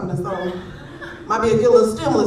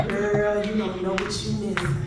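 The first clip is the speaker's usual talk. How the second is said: lightly voiced old deacon; 4 semitones lower, 7 dB quieter than usual. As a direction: croon it like an old church deacon, softer. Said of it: "He always be like".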